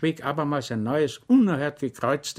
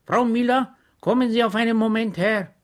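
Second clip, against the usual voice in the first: Schwejk calls out, higher and louder; a raised call to the kitchen; calling from bed; 10 semitones higher, 5 dB louder than usual. A narrator's reading. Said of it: "die leise Stimme Schweigs". (Schwejk ruft die Bedienerin, höher und lauter)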